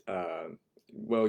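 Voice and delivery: normal talking voice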